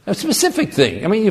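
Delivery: ordinary delivery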